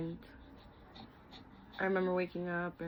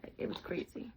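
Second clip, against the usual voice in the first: whispered; 6 dB quieter than usual. Real, not acted. Hushed aside.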